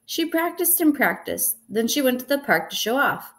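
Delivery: plain reading voice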